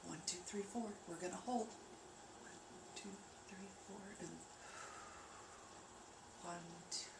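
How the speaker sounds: soft counting. Shown as a demonstration. Guiding viewers through breathing, soft slow counting. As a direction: count the breaths gently and slowly. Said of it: "So take a slow breath in".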